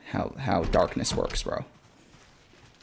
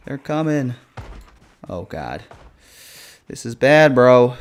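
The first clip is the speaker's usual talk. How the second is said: scared horror game streamer; 5 dB louder than usual. Real, not acted. Zombies chasing him.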